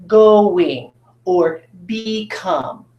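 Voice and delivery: "going" and "become" slowly, first vowels long; long open vowels. Shown as a demonstration. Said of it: "it's gonna say its name".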